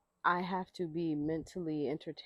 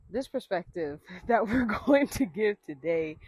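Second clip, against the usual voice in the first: loud, higher restart broken by a laugh; laughing self-correction; 4 semitones higher, 9 dB louder than usual. Real, not acted. Host restarts the intro for the viewers, laughing loudly. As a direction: redo the line big and deliberate, laughing.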